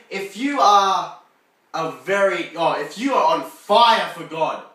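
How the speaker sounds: ordinary talk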